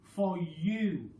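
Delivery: put-on a keen boy pleasing his grandma; George, eager boy.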